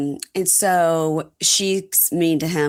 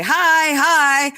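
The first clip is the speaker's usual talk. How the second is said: high, louder chirpy hi hi; friendly greeting; 11 semitones higher, 5 dB louder than usual. Retold or imitated. Mimicking how Pennsylvanians greet, high and chirpy. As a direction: sing out a friendly small-town hello.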